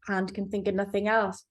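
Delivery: ordinary delivery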